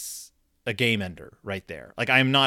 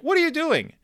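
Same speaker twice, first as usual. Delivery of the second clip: high, raised, fed-up question; exasperated dad; 4 dB louder than usual. Retold or imitated. Fed-up dad storms over to son, exasperated high question. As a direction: demand an answer, out of patience.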